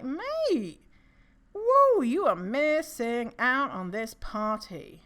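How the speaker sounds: high, slow, drawn-out brag; hammed-up boasting. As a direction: stretch it out like a show-off. Boasts to those rejecting them.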